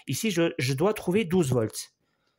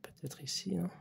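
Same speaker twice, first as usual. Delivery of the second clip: whispered aside; 15 dB quieter than usual. Real, not acted. Probing the board, muttering to self.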